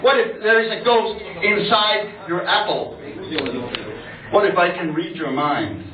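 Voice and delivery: rhetorical spoken-word poetry reading; performed poem. Acted. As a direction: fire off insistent questions to an audience.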